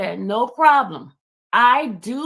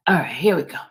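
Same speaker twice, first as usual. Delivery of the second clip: ordinary delivery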